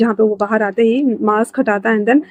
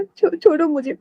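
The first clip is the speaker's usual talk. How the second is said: 1 scared refusal; 5 semitones higher, 4 dB quieter than usual. Acted. Scared pushing away.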